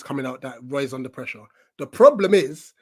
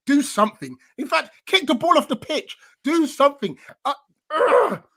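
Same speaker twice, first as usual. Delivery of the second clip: much higher, exasperated outburst; annoyed; 9 semitones higher, 3 dB louder than usual. Real, not acted. Fan rants at his defender, exasperated and much higher. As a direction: boil over at the defender. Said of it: "I'm just so annoyed right now".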